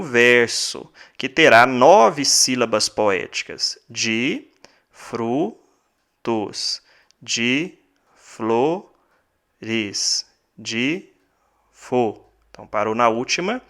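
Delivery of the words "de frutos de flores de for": slow, soft, each poetic syllable set apart with pauses; split into syllables. Shown as a demonstration. Teacher dividing the last verse for students, slow and syllable by syllable. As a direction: break the verse into its syllables, softly. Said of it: "nós contamos até aqui".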